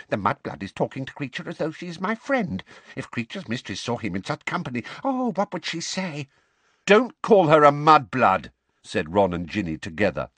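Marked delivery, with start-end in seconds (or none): 0.1-6.3 high-pitched, resentful elf muttering; furious muttering. A narrator's reading. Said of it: "muttered faster and more furiously than ever". 6.9-8.5 loud, high, angry outburst. A narrator's reading. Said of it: "very angrily".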